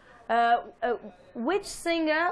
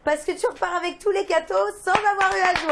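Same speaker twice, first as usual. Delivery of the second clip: excited announcing; 3 semitones higher, 7 dB louder than usual. Real, not acted. Announcing prizes to caller.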